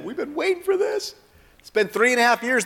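high, thrilled cry of longing; excited disciples. Acted. Preacher voicing disciples thrilled the king has come. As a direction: burst out with eager joy.

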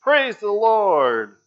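high, loud, slow joyful cry; lady shouting over the groceries. Acted. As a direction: holler out thanks. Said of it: "and shouted".

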